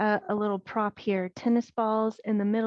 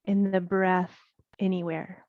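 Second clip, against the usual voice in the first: unhurried yoga breathing guidance with long pauses; guided meditation. Acted. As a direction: guide them through the breath, leaving space between phrases. Leads guided breath practice.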